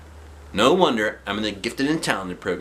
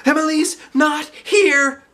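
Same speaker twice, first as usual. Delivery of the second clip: high, slow panicked shout; 7 dB louder, slower than usual. Acted. Andrea realizing her friend is missing, shouting. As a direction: call it out in alarm. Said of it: "shouted Andrea".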